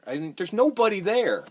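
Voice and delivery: normal talking voice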